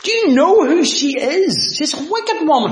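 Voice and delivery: high, loud, scoffing with a laugh; sneering Pharisee. Acted. Pharisee scorning the weeping woman, high scoffing voice. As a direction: sneer it out like a self-righteous host.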